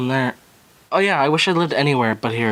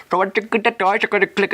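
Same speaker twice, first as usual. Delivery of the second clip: higher, garbled mock Indian accent; 6 semitones higher than usual. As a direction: imitate an Indian-accented explainer, pitched up. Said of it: "it's a quiet mic and they're like".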